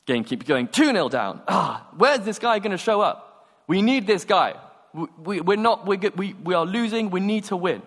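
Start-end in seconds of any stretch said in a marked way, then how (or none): 1.5-7.8 higher-pitched, exasperated complaint; acted frustration. Losing footballer demanding the promised player, frustrated. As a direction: fret like a player on the losing side.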